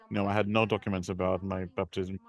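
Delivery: ordinary talk, nothing marked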